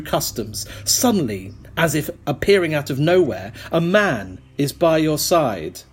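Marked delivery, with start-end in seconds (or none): none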